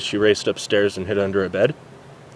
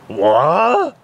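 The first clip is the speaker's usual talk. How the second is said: confused what; 8 semitones higher, 5 dB louder than usual. Acted. Doctor confused.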